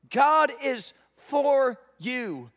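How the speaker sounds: loud, slow, drawn-out refrain; loud proclamation. Acted. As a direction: proclaim each word to the whole hall. Sermon refrain, building.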